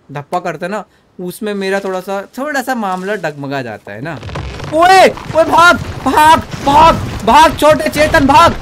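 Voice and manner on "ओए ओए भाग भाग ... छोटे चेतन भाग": high, loud frantic yells; urgent shouting. Real, not acted. Gamer yelling at his character to run, urgent shouting. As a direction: yell at them to run for it. Urging game character to flee.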